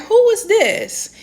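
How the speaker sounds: high, slow feigned ignorance; mock clueless. Woman replying to an ex's text, faking not knowing him. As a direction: act like you've never heard of him.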